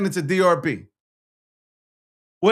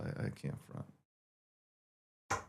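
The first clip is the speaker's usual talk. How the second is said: whisper; 21 dB quieter, much slower than usual. Real, not acted. Bragging aside to chat.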